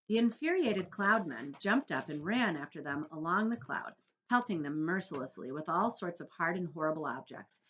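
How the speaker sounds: ordinary delivery, nothing marked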